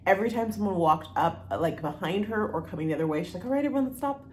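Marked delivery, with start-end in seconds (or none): 3.4-4.2 higher, lightly voiced trail mom; bossy mom voice. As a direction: herd the kids like a cheerful mom. Mimics the hiking mom.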